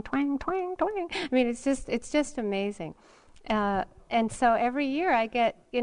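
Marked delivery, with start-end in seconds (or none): 0.0-1.1 twang rising higher and higher, singsong; mock twanging music. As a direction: imitate a blaring loudspeaker twang climbing up in pitch. Imitating the loudspeaker music.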